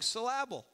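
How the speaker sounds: "syllable" as suh-LAB-ul, stress on the middle; syllable mis-stressed. Shown as a demonstration. Preacher chiding the church's emphasis, "syllable" said suh-LAB-ul. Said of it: "I'm trying to be funny".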